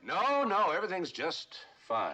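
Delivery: loud, high reassurance through the wall; calling out. Acted. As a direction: call out hastily that all is well.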